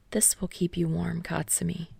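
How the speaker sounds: hushed, near-whispered reassurance; soft promise. A narrator's reading. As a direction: promise it tenderly under the breath. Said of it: "Kirishima promised".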